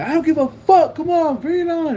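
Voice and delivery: high, loud mock bravado; retold defiant dare. Seller answering threat of cousins, high loud defiance. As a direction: dare him to come back, reenacted.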